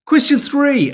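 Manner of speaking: loud, high game-show call; question announcement. Acted. Quiz host calling out the next question to viewers, loud and high. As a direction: boom out the next round.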